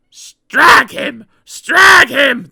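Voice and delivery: loud, high gleeful yell; a mob hollering; trucks yelling. A narrator's reading. Loaded trucks yelling as they charge Peter Sam. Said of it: "they yelled".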